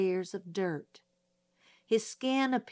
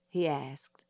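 usual speaking voice

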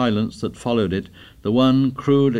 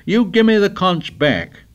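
demanding; 10 semitones higher, 5 dB louder, slower than usual. Acted. Tussle over the conch.

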